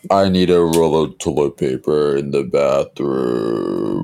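slow, low Vader voice; mock Darth Vader. Cohost's Vader asking for toilet paper, slow and low. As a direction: make a chore request sound menacing. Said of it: "Imagine that guy".

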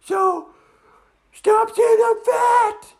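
very high, loud outraged squeal; offended girl dragon. Acted. Dragon takes offence at the kids, high indignant cry. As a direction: demand it like an insulted girly dragon. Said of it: "And she said".

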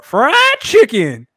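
loud, high, drawn-out exclamation; mocking her delivery. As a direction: belt it out slowly and high, imitating her. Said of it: "It's the way she said it".

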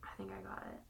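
soft whisper. Real, not acted. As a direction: hush it out.

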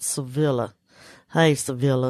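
a lightly put-on Southern accent, savoring Sevilla. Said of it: "I would wanna say in a Southern accent".

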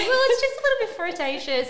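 high, loud, quick prissy reasoning; prim caution. Retold or imitated. Host mocking her own cautious reaction, high and fast. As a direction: play it the prim, careful one.

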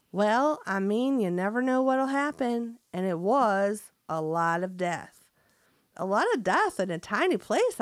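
folksy Southern farmer voice; hammed-up country farmer; best country accent. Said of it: "That was my best country accent".

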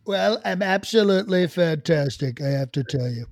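loud Trump-style opening; loud, pompous presidential greeting; president impression. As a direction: open big in the president's voice. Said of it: "I don't need to be the president".

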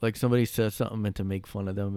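normal talking voice

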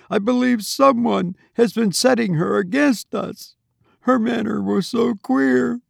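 put-on a worried young wife pouring out her grievance; distressed young wife. A narrator's reading. Laura's voice, distressed.

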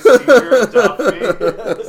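loud, slow words broken by laughter; can't get it out for laughing; laughing loudly. Real, not acted. Cohost begging to be adopted, laughing out loud.

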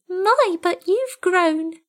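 a kitten waking to a place it knows; newborn kitten voice. A narrator's reading. Tiny kitten, high and slow in wonder.

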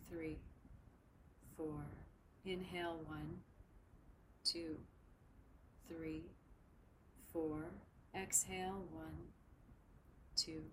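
slow, even counting with long pauses; slow breath counting. Shown as a demonstration. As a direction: count each breath slowly and softly. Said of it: "We'll begin to shape our breath together".

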